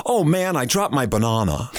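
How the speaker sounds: imitated kid's muddled US-British accent, starting high; American kid slipping into British vowels; mixed-up Anglo-American accent. Father mimics son's confused speech, mixed-up Anglo-American accent. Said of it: "he was already mixed up".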